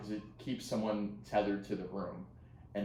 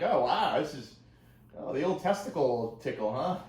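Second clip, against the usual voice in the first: put-on amused teen; 6 semitones higher, 7 dB louder than usual. Teen self quoted amused.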